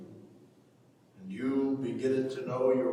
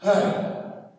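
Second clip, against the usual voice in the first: loud, breathy sigh; heavy sigh; 4 semitones higher, 6 dB louder than usual. Acted. Father sighs at his son before speaking, heavy and loud. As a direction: let out a weary heavy sigh.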